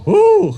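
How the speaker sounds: loud high whoop; excited whoop. Real, not acted. Emcee whooping about the deer lease. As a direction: let out a big excited woo.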